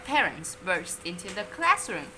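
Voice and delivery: usual speaking voice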